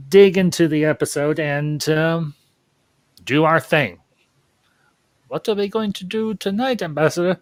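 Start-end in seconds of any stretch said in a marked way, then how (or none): 5.3-7.4 higher, silly sidekick voice asking eagerly; cartoon sidekick asking the nightly question; Pinky-style sidekick. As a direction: play the goofy sidekick with a lightly put-on voice. Pinky and the Brain parody setup.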